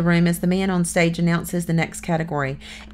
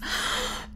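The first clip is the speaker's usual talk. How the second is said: sharp gasp; 8 dB quieter than usual. Acted. Kermit blanches at bad news.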